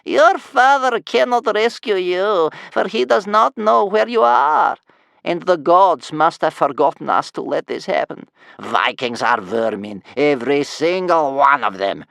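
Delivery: put-on loud, slow, high doom-laden old woman; scary granny spitting doom and fury; furious rasping grandmother. A narrator's reading. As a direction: rasp it out furiously, drawing the words out. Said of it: "furious voice of Bear Cub's scary grandmother".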